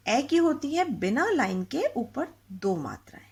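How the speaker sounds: teacher whispering the rule. Shown as a demonstration. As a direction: whisper it like a secret to the class.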